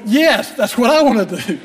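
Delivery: laughing through a sheepish confession. Retold or imitated. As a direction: chuckle as you admit the wish. Admits the wish, laughing.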